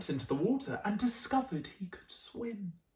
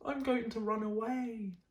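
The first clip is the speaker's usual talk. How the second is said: sad cry; 4 semitones higher than usual. Acted. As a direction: sound lost and ready to leave home. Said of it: "And he cried".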